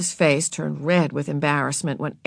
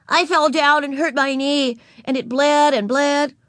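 put-on little girl voice; 8 semitones higher, 4 dB louder than usual. A narrator's reading. Narrator voicing Ramona to Beezus, high and childlike. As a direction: play four-year-old Ramona, proud.

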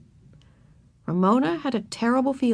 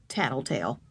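lower, soft scornful thought; 7 semitones lower, 7 dB quieter than usual. A narrator's reading. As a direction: sneer it under your breath. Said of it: "thought Ramona scornfully".

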